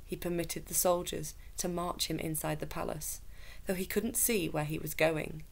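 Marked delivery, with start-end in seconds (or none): none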